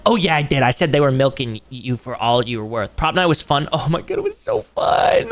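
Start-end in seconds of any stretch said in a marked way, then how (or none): 3.7-5.3 high, squealed gushing; gushing excitement. Acted. Streamer gushing about prop night, high excited squeal. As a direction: squeal it out, far above your usual pitch.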